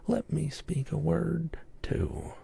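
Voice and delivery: usual speaking voice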